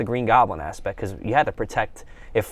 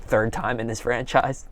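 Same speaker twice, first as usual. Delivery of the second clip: ordinary talk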